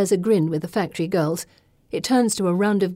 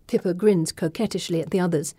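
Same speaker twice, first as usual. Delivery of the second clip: plain reading voice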